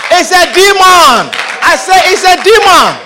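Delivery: loud, high preacher's declaration; fiery sermon cry; preaching shout. Real, not acted. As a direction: proclaim it to the congregation. Preaching at full force.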